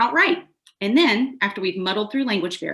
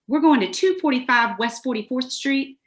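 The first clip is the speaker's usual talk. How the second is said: Southern tourist; 5 semitones higher than usual. Acted. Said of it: "usually a Southerner over the age of fifty".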